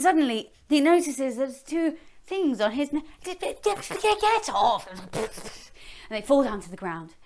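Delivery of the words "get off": high, sharp shout. Acted. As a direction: yell them off your neck. Dragon shakes them off.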